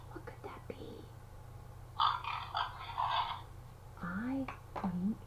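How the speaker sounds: soft suspenseful whisper; hushed guessing game with a child. Pretend. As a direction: keep the secret a moment longer. Whispered suspense before reveal.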